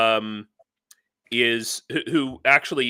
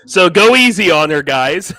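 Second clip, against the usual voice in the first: louder, higher teasing call to the chat; playful call-out; 5 semitones higher, 12 dB louder than usual. Real, not acted. Host tells the stream to go easy on the guest, playful loud call. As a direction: raise the voice to warn the viewers, joking.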